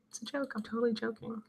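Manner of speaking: very soft, under the breath; hushed disclaimer. Real, not acted. Reassuring the chat she's totally joking, hushed. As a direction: drop way down in volume, like an aside.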